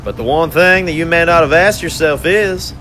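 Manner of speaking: high, taunting villain voice, savoring the catch; gloating villain conductor. Acted. Conductor gloats over his handcuffed captives, high and mocking. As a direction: purr over the trapped prisoners like a smug torturer.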